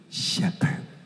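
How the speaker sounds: hushed key word; whispered shepherd. Real, not acted. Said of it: "emphatic hushed word".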